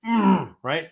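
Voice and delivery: ordinary talk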